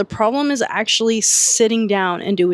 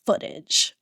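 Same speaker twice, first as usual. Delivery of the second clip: ordinary talk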